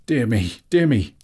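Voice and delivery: high, loud exclamation, rising on the repeat; flustered hobbit. A narrator's reading. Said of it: "said Bilbo".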